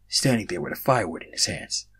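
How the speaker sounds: ordinary delivery, nothing marked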